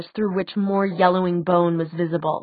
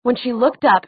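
ordinary delivery

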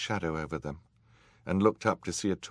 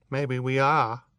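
usual speaking voice

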